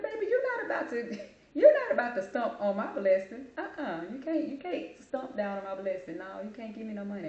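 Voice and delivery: high, laughing mock-scold; laughing, sassy no-way-baby refusal. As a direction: playfully tell him off, way up high. Refusing money, sassy retold reply.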